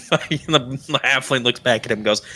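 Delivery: normal talking voice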